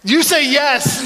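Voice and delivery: laughing, slow, high coaxing; playful laughing nudge; laughing prompt. Real, not acted. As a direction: laugh while coaxing the crowd to answer. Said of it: "Ladies, help me out".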